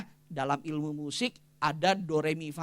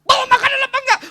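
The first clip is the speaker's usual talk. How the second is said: high, loud mock yell; husband yelling; 14 dB louder, faster than usual. Husband yelling at wife coming home late, hammed-up shout. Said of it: "Suami teriak-teriak".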